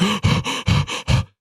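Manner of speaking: quick voiceless panicked gasps; imitated gasping fit; re-enacted hyperventilating. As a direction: pant as if unable to catch breath. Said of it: "I was breathing just like that".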